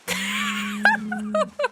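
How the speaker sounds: very high squeal of laughter. Real, not acted. Streamer losing it over the cow, squealing laugh. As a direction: burst into a shrieking laugh.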